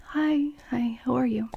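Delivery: breathy timid little hello, whispered; meek whispered hello. Shown as a demonstration. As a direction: shrink away and whisper the greeting. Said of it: "they don't say".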